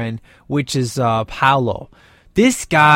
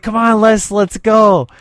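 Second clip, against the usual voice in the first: louder, higher call to Les; 5 semitones higher, 5 dB louder than usual. Retold or imitated. Dean calls Les from the car, a mock call. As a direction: shout it at your friend to come. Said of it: "He's like".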